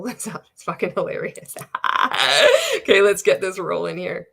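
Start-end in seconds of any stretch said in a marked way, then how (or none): 1.6-2.7 high, loud real laugh; burst of laughter. Real, not acted. Scrolling the MLM coach's page, bursting into real laughter. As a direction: crack up at the absurd post.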